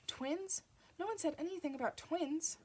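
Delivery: "Twins?" shot up high in alarm. A narrator's reading. Father realises twins, rising alarm. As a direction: let the alarm jump the pitch up on "Twins?".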